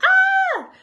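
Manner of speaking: one sudden burst of terror; imitated scream. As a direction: let out the panicked scream she held back. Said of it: "I wanted to start screaming".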